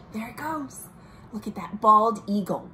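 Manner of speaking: ordinary delivery, nothing marked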